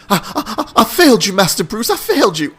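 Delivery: high, stammering mock sobs; imitated blubbering. Butler at his master's grave, imitated blubbering. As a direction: act out a weeping butler's breakdown. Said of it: "he's crying and blubbering".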